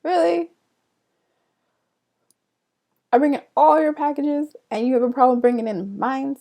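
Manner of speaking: loud, high exasperated "Really?"; indignant complaint. Retold or imitated. Scolding dad for leaving her package outside, loud and indignant. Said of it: "I was just like".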